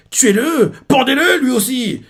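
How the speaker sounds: cris très aigus et forts; ordre crié. Retold or imitated. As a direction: aboie l'ordre d'exécution. Superviseur ordonne l'exécution. (le superviseur hurle de pendre Chihiro)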